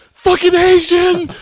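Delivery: very high, loud, slow furious yell; road rage yell. Retold or imitated. Friend imitating his dad cut off on the freeway, loud high furious yell. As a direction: scream it at the other driver. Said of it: "and he went".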